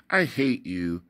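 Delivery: plain reading voice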